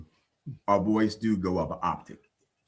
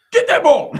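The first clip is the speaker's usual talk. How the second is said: high, loud playful shout; sideline coach yell; 10 dB louder than usual. Pretend. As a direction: yell it across a sporting field. You're in a sporting field.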